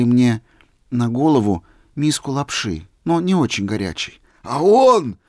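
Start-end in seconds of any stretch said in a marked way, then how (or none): 4.4-5.2 loud, high outburst; excited shout. A narrator's reading. Old man leaping up, shouting eagerly. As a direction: jump up and cry out. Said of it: "закричал, вскакивая".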